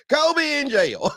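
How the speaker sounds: high, slow words through laughter; cracking up mid-sentence; real laughter breaking through; laughing. Real, not acted. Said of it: "I'm laughing".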